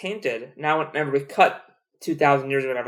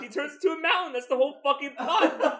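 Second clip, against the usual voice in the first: high squeal through real laughter; laughing protest; 12 semitones higher, 5 dB quieter than usual. Real, not acted. Defending the plot to a disagreeing friend, laughing squeal. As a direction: insist on it while cracking up.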